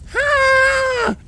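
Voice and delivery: very high, loud startled yelp. Acted. Bon Bon yelping in shock at Lyra's news. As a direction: yelp in alarm.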